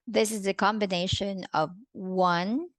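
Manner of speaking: ordinary talk, nothing marked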